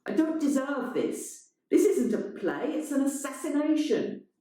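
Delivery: high, aggrieved; wounded outrage. A narrator's reading.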